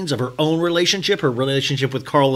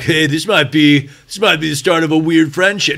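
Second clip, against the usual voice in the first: mock Corey voice; 4 dB louder than usual. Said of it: "No, sorry".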